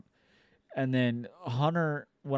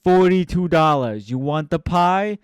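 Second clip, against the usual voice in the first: loud, raised shouting of the price; mock angry pizza guy; 4 semitones higher, 11 dB louder than usual. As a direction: yell it at her like she's dense. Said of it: "He's like".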